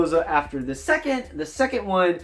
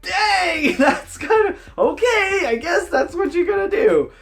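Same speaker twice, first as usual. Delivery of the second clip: mock surprise; 10 semitones higher, 3 dB louder than usual. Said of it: "my wife and I are both kinda like".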